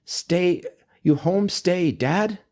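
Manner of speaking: high-pitched mock German accent, questioning; thick European accent. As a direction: imitate an older woman with a thick German accent. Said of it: "like, really thick European accent".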